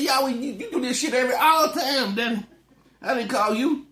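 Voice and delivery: put-on high, loud child's whine; whiny kid voice. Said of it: "he's like".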